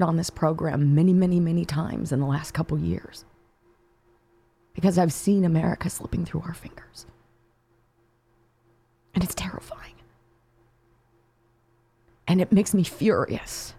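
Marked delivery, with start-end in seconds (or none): 4.7-9.9 fearful whisper. Real, not acted.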